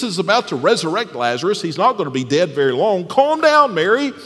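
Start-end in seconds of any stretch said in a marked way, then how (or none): 3.1-4.1 mock calm down. Mock Jesus dismissing Mary.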